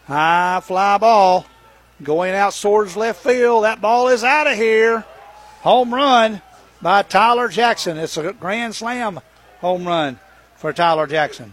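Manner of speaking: rising excited shout as ball leaves; home run call. Real, not acted. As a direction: build the call to a big shout.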